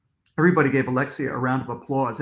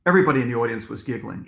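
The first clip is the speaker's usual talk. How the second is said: plain reading voice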